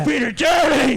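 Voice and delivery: loud high mock yell; mock shout. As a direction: yell it out in fake disgust.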